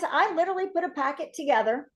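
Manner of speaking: ordinary delivery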